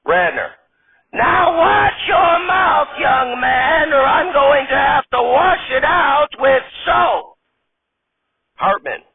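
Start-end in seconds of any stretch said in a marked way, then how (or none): 1.1-7.4 high, slow, loud scolding granny; high granny voice, loud and stern; old granny scolding. Acted. Old lady character voice.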